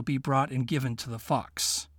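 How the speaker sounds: ordinary delivery, nothing marked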